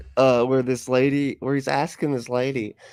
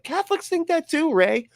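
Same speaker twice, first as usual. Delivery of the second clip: much higher, exasperated squeal; exasperated retort. Retold or imitated. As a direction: shriek it at him in disbelief. Exasperated retort to Ray Comfort.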